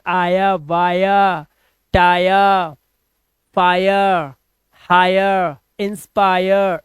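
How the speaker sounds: टायर, फायर, हायर ending in a long "aa-ya"; slow, level वाया with the r softened away; drawn-out aaya ending. Shown as a demonstration. Teacher drilling the sound, इंस्पायर drawn out as "aa-ya". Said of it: "आया कर रहा हूं मैं".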